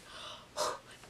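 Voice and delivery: big audible intake of breath; gasp of relief. Retold or imitated. Storyteller realizing the teasing ended, relieved gasp. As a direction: gasp as if finally free. Mimes relief at being forgotten.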